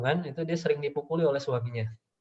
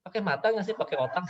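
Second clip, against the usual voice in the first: higher, fast berating; scolding parent; 7 semitones higher, faster than usual. Retold or imitated. Parent berating the child for clumsy walking, fast and scolding. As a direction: rattle off the blame.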